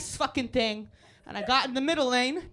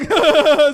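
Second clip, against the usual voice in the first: loud taunting laugh; mocking laugh; 2 semitones higher, 13 dB louder than usual. Comic retelling taunting the driver, loud laugh. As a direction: laugh loudly in his face. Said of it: "And I was like".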